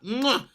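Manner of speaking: kiss it defiantly; scarf kiss. Retold or imitated. Fan kissing his scarf to defy a colleague.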